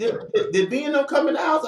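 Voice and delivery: put-on high, fast girlfriend voice; girlfriend asking. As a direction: rattle it off like his girlfriend.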